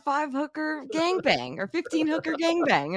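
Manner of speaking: high, heated mock rant; mock Adam rant. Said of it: "Adam's like".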